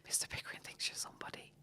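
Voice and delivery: soft breathless whisper; girl behind the door whispering in awe; awed whisper. Retold or imitated. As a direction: whisper it to yourself in wonder. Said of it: "me behind the door, like, I grew".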